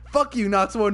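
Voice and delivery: ordinary talk, nothing marked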